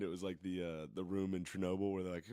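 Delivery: ordinary talk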